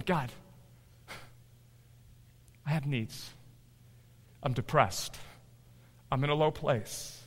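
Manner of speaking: soft, halting prayer with long pauses; hushed pleading. Acted. Believer on knees confessing needs to God, hushed pleading. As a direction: plead quietly, one short phrase at a time.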